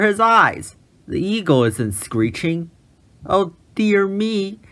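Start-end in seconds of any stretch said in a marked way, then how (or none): 3.2-4.6 wailing. A narrator's reading. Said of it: "he wailed".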